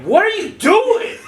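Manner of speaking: high, drawn-out incredulous demand; retold outraged shout. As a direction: cry out in mock outrage. Retells confronting bunkmate on bunk.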